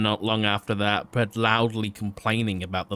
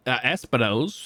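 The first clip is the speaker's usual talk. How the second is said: usual speaking voice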